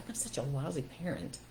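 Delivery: soft, deflated mutter of self-blame; quiet, beaten-down inner voice; defeated self-talk. Shown as a demonstration. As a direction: say it like you've given up on yourself. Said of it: "if you don't think you're a good parent, you go".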